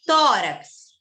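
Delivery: "Tórax" with a loud, long TÓ; emphatic demo, weight on TÓ in "Tórax"; stress on TÓ. Shown as a demonstration. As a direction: punch the first syllable of "Tórax", let rax fall. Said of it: "é no tó".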